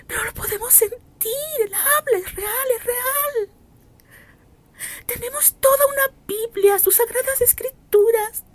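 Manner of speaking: high, tearful voice breaking with emotion; weeping testimony. Real, not acted. Preacher tells her brothers the Holy Spirit is real, weeping. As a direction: cry out that it is real, overcome by tears.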